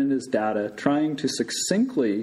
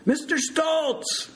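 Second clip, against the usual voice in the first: slow, high holler of a name; loud shout; slower than usual. A narrator's reading. Big Bird to patient who won't answer, shouting. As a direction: yell the name to get an answer. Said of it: "he shouted loudly again".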